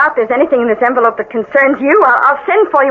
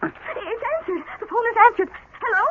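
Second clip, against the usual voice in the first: hushed phone talk; 3 semitones higher, 10 dB quieter than usual. Acted. Hushed aside, then on the phone.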